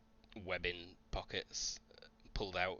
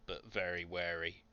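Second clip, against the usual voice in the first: ordinary talk, nothing marked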